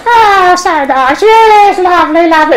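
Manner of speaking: high, cooing puppet voice; gushing puppet delight; doting Punch. Acted. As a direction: coo over the baby in a high voice. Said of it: "Punch initially likes the baby".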